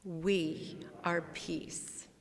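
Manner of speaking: very slow, each word weighted; slow spoken mantra; slow affirmation. Shown as a demonstration. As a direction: lay down each word slowly. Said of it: "Say it again".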